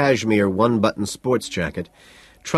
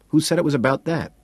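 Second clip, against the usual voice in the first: insistent, raised repeat; 6 semitones higher, faster than usual. A narrator's reading. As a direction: press the question harder. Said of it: "he asks again".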